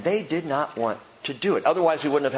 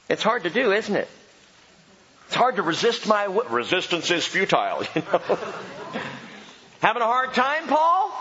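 high, playful teasing voice; mock-sympathetic Jesus ribbing Paul; 7 semitones higher than usual. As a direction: tease him like a knowing friend.

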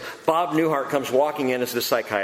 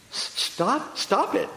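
halting, higher puzzled question; timid, confused patient; baffled echo; 6 semitones higher, slower than usual. Retold or imitated. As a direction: echo it back, not understanding. Baffled patient echoes the therapist.

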